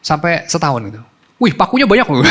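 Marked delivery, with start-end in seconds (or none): 1.4-2.2 excited exclaim. Retold or imitated. Child exclaims at nails.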